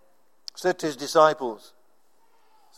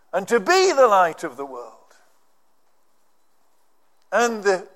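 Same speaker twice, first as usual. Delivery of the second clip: ordinary talk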